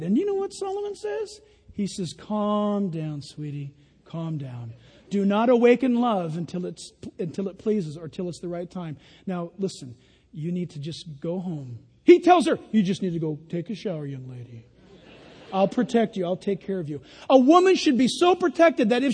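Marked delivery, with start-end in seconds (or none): 2.1-11.8 much softer, lower gentle hush; calm her down tenderly; soothing calm-down. Acted. Solomon calming his excited bride, soft and tender. 12.7-17.0 soft, low tender reassurance; gentle protective. Acted. Solomon sending the young lady home, soft and protective.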